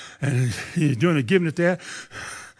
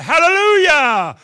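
much higher, louder, slow drawn-out shout; shouted hallelujah; 12 semitones higher, 10 dB louder, slower than usual. Retold or imitated. Preacher on the mic shouting a slow, loud hallelujah. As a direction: belt it out to break through the stutter. Bursting out on live radio.

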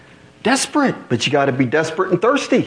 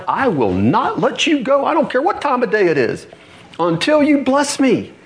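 determined Jacob; 8 semitones higher than usual. Retold or imitated. Jacob refusing to let go.

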